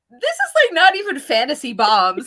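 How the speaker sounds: high, loud incredulous laugh; laughing disbelief. Retold or imitated. Reader mocks the fantasy bomb, laughing high and loud. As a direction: scoff at the grenade like a real bomb. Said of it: "I had, like, the same kind of".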